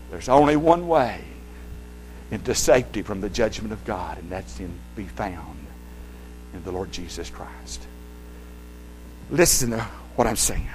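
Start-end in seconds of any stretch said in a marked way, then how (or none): 4.6-10.7 whisper. Real, not acted. Preacher drops to a whisper.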